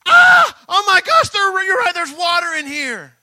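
hammed-up shriek, very high and loud; mock panic. Preacher imagining Jesus panicking too, hammed-up shriek. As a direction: play Jesus freaking out for a laugh. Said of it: "And Jesus said".

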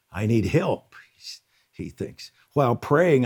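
usual speaking voice

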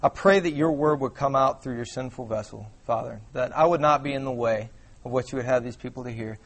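low, hushed, reverent praying; prayer. Real, not acted. Preacher praying before his congregation, low and soft. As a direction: pray softly and humbly, voice low.

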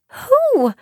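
put-on very high, startled girl; high-pitched bewilderment; startled question. A narrator's reading. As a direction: squeak the question in alarm.

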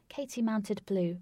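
ordinary delivery, nothing marked

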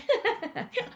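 a high, real laugh through held-back tears; tearful laugh. Real, not acted. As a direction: laugh it off while close to crying. Laugh while fighting tears.

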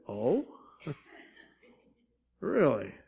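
low, soft, amused oh really; wry mock surprise. Preacher voicing God's reply to Jonah's refusal, low wry mock surprise. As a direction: let it drip with wry disbelief.

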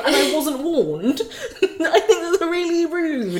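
slow, high, laughing through it; mock-offended while cracking up; laughing indignation. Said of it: "the biggest thought that went through my head is like".

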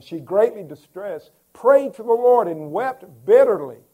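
high, loud anguished reading; fervent preaching. Acted. Preacher reading how Hannah wept, loud and anguished.

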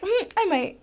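high, teasing sing-song; mock sing-song. Joking about middle school, high sing-song. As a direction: sing it high like a dark joke. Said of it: "It was just like".

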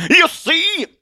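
very high, loud cry of vindication; exultant rising exclamation; triumphant preacher. Acted. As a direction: crow it to the crowd. Atheist triumphs over doubters.

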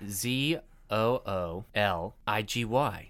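slow letter-by-letter spelling. Shown as a demonstration. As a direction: spell each letter out slowly. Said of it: "The word is zoology".

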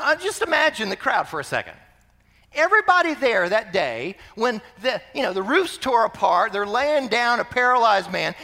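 ordinary talk, nothing marked

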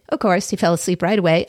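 ordinary delivery

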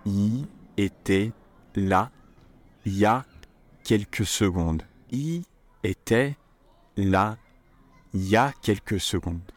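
slow, spaced-out model phrase; slow teacher demo with pauses; said slowly. Shown as a demonstration. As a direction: break it into slow chunks for repeating. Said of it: "I'm going to say it slowly first".

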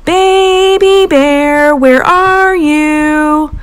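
slow, high, distant call; mother calling from the woods, far off; calling from afar. A narrator's reading. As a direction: call long across the woods. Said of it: "my mom calling from the woods".

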